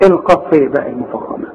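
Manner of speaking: normal talking voice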